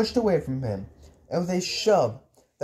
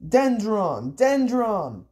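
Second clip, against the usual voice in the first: high-pitched call, only moderately loud; restrained narrator's scream; mild acted scream; 6 semitones higher, 3 dB louder, much slower than usual. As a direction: cry it out high, like a command.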